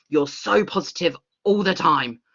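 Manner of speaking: put-on gushing positivity; mock toxic positivity. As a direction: imitate someone relentlessly upbeat. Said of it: "toxic positivity".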